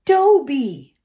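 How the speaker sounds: loud, high cry of recognition; startled boy crying out a name; surprised shout. A narrator's reading. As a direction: blurt the name out in shock. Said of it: "and then".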